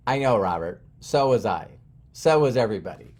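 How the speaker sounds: low, soft mentor voice; calm reassurance. Retold or imitated. Coach soothing scared Robert, calm and low. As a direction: reassure gently, pitched well down.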